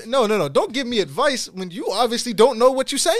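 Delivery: high, loud comic scolding; indignant retort. Retold or imitated. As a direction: shut the advice down in exasperation. Mock retort to the officer's advice.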